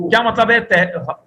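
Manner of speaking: ordinary delivery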